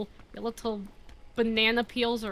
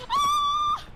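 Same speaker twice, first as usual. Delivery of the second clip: scared scream. Real, not acted. Falling, screaming.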